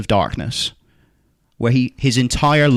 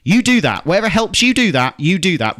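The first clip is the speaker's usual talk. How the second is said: emphatic; 7 semitones higher than usual. Real, not acted. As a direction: push it with enthusiasm. Urging the star on.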